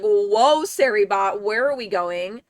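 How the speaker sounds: loud, drawn-out mock objection; mock alarm. Streamer retelling her reaction to the bot's joke, mock alarm. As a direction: call out the bot in mock shock.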